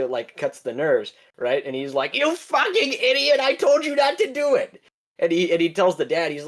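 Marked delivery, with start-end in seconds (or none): 2.1-4.8 villain voice pitched way up, yelling; mock angry villain. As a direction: screech at the idiot. Bad guy berates orange guy.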